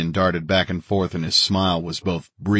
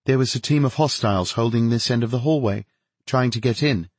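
higher-pitched voice for a woman officer; female officer's report; 5 semitones higher than usual. A narrator's reading. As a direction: report it in a lifted, contained voice.

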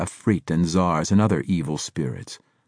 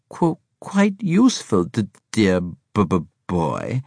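lively, higher, emphatic stammer on opening consonants; gleeful stuttering schemer; 5 semitones higher, 5 dB louder than usual. A narrator's reading. Braithwaite explaining the plot to the Scorpion, gleeful stutter. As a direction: stutter with relish, swooping up then settling.

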